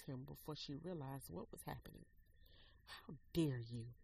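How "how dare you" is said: low, loud and slow, through rising fury; low seething outrage after the slap. Acted. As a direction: spit each word out slowly, low and hard. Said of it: "she seethed".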